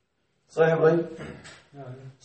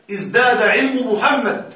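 slow example read; 3 semitones higher, slower than usual. Shown as a demonstration. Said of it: "طب هنا".

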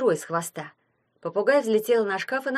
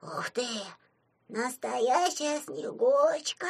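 put-on soft, slow childlike wonder; awed amazement; 5 semitones higher, 4 dB quieter, slower than usual. A narrator's reading. Puzatik meeting a real Snow Maiden, hushed amazement. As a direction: breathe it out slowly, rising high in wonder. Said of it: "поразился Пузатик".

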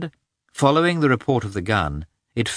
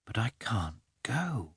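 dog's inner voice; 2 semitones higher, 12 dB quieter than usual. A narrator's reading.

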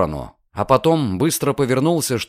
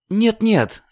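sharp refusal; 10 semitones higher than usual. Acted. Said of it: "резко объявила Диана".

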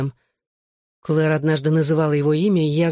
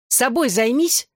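higher, drawn-out rebuff; curt retort; 7 semitones higher, slower than usual. A narrator's reading. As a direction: cut him off with mild irritation. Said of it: "отрезала Лиззи".

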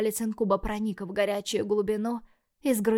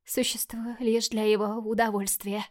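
broken whisper. Acted. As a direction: voice gone to a whisper. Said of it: "Голос Мишель сорвался".

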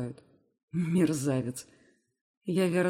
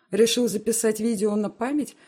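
usual speaking voice

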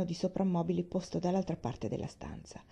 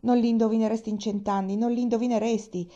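higher and louder, brimming with news; excited teasing; 4 semitones higher, 6 dB louder than usual. A narrator's reading. Nancy teasing Tom about the visitor: excited, higher, louder. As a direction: tease him that he'll never guess, louder. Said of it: "disse ansimando".

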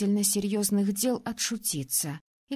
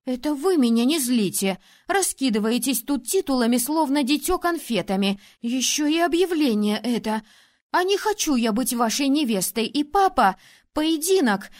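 loud, furious tirade; angry outburst; 3 semitones higher, 5 dB louder than usual. Acted. Princess rages at the ruler over the engagement. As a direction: let your anger pour out at him.

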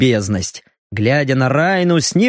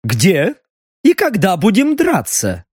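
condescending; 4 semitones higher than usual. A narrator's reading. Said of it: "снисходительно поинтересовался Губерт".

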